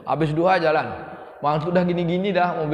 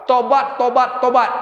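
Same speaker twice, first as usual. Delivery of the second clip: loud, higher repeated cry of tobat; urgent call; 8 semitones higher, 7 dB louder than usual. Acted. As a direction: drive the word home to the crowd. Preacher urges repentance, repeated.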